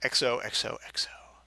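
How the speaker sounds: whispered hugs and kisses; whispered sign-off. Acted. Reader whispers Kyle's letter sign-off. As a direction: breathe it out secretively.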